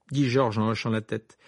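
usual speaking voice

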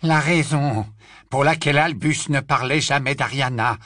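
ordinary delivery

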